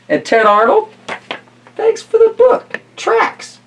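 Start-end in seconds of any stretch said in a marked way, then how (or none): 1.7-3.6 high cheery thanks. Real, not acted. Thanking authors.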